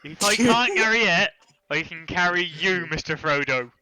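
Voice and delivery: loud hammed-up Somerset hobbit; Somerset accent. Game master playing Frodo's loyal companion, hammed-up Somerset accent. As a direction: declaim it in broad mock Somerset. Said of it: "I'm really good at the Somerset accent".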